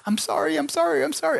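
put-on very high, rapid-fire child's apology; high-pitched mock child pleading; imitating a sorry kid. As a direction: squeak out repeated apologies like a kid. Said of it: "one of your kids".

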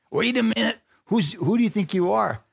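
high, sharp disbelieving retort; mock Pharisee outrage; indignant challenge. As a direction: scoff at his claim.

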